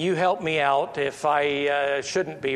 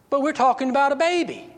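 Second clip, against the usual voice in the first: incredulous; 10 semitones higher, 3 dB louder, much faster than usual. Real, not acted. Incredulous rhetorical question to congregation.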